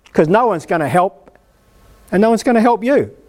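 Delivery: ordinary talk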